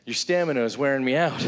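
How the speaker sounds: acted panting, puffing between short phrases; winded brother begging a break; out of breath. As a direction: gasp it out as if winded. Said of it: "He's out of breath, he's breathing hard".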